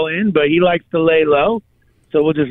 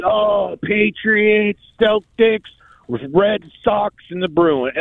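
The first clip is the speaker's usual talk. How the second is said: retold sports-fan rant, pitched up; higher-pitched, carried-away listing of teams; going off excitedly; 6 semitones higher, slower than usual. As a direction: imitate someone going off about their home teams. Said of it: "And she went off".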